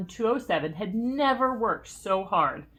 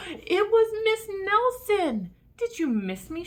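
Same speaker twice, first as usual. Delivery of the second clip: delighted surprise; 13 semitones higher, 6 dB louder than usual. A narrator's reading. Miss Nelson returns, joyful.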